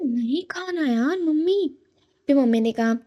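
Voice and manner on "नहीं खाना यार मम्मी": exasperated refusal. Retold or imitated. Fed-up daughter refusing chivda.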